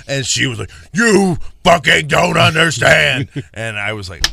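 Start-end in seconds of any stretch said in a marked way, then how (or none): 0.9-3.3 slow, menacing growl of a furious wife; slow demonic snarl, mock furious. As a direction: drag the words out like a possessed wife. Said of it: "Her voice got deeper".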